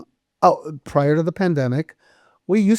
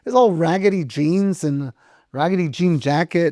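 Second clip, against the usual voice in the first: ordinary delivery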